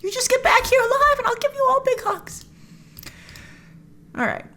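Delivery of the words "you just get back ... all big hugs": hammed-up tender promise of hugs; mock tender. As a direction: comfort them like a doting mom. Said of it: "I was like".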